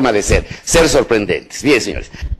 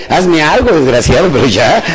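mildly exasperated, louder mock plea; exasperated demand; 3 dB louder than usual. Woman pleading with her partner for some surprise, exasperated. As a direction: act out a fed-up partner's demand.

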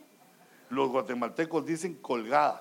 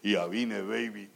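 macho greeting; 6 semitones lower than usual. Shown as a demonstration. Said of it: "Puro Terminator".

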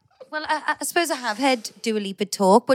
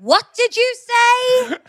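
loud, high, drawn-out challenge; hammed-up indignant partner, stretching each word slowly; mock jealous outrage; 13 semitones higher, 6 dB louder, much slower than usual. Acts out jealous partner's reaction.

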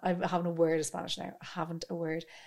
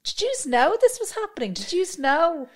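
disbelief; 8 semitones higher, 5 dB louder than usual. Retold or imitated. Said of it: "And you're like".

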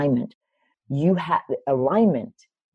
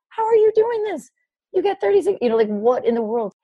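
very high, baffled outburst; acted-out astonished questioning; incredulous. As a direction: squeal it in sheer disbelief. Retelling her amazement at his success.

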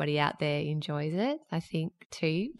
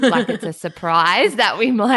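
laughing through the words, louder; amused, half laughing as she speaks; a giggle running under an ironic remark; 4 semitones higher, 8 dB louder than usual. Real, not acted. Joking about condescending listener praise.

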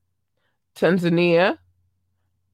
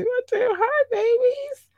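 much higher, slow, squeaky hi babies; gushing squeal. Real, not acted. Host cooing hello to her viewers in a squeaky squeal. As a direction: coo it out almost crying with joy.

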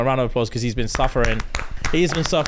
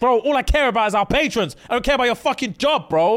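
mock indignant; 9 semitones higher than usual. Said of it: "I'm like".

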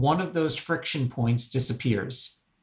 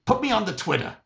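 impersonation of mom; 7 semitones higher than usual. Retold or imitated. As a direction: put on an insistent old mom's voice, pitched up. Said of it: "this is my impersonation of my mom".

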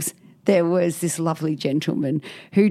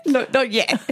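usual speaking voice

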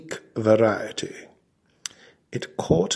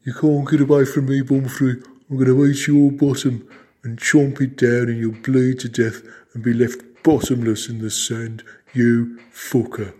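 put-on gloating camp Birmingham accent; 2 semitones higher, 5 dB louder than usual. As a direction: crow over the fleeing prey in a camp Brummie voice. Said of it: "began to gloat".

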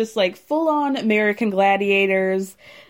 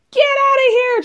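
very high, loud screamed order; imitated scream; 5 dB louder than usual. Acting out a woman charging at the door, screaming. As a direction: shriek it as one sustained high burst.

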